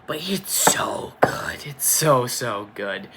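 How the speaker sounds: breathy whisper of awe; hushed, almost teary praise for the film; awed whisper. Real, not acted. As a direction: whisper it like you can't get over it. Swooning over the film.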